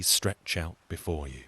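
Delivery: ordinary delivery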